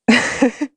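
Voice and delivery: a light, high burst of laughter. Real, not acted. Telling the host about Jesus, laughs high and bright. Laughing at her own story.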